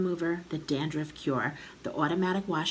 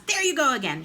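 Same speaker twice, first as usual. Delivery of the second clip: louder, higher exasperated outburst; cross cry; 8 semitones higher, 5 dB louder, faster than usual. A narrator's reading. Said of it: "she cried".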